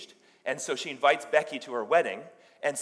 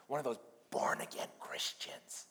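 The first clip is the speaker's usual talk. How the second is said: unvoiced conspiratorial whisper; whispered aside; 9 dB quieter than usual. Hairdresser confiding about her born-again brother, whispered. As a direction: whisper it like an embarrassing family secret. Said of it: "and she whispered over".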